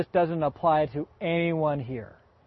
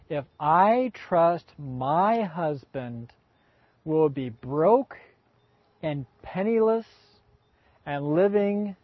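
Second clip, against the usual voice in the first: slow fearful musing; much slower than usual. Acted. Voicing wives' fears.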